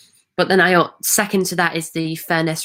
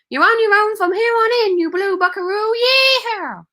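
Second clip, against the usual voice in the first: Tumbleweed's American accent; 13 semitones higher than usual. Shown as a demonstration. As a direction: put on a hammed-up American accent, stretching the words high. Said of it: "Try and do a Tumbleweed impression".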